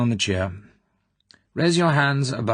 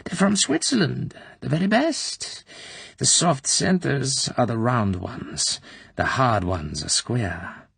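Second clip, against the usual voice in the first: much higher, then slow sugary chatter; sweet prattle; 6 semitones higher, slower than usual. A narrator's reading. Colonel offering chocolates, sugary prattle. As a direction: coo over the chocolates. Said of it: "The ghastly prattle".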